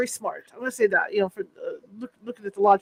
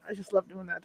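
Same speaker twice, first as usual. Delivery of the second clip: ordinary talk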